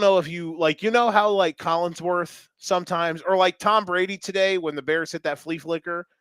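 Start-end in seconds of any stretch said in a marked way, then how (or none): none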